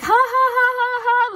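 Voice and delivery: high, loud mocking cackle of a smug woman; mocking laugh. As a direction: cackle like she's playing games. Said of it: "She's like".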